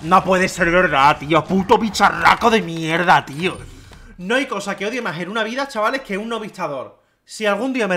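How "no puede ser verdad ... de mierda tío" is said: loud, slow furious rant; slow, loud frustrated cursing; angry. Real, not acted. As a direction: vent the rage at the monster. Furious after dying.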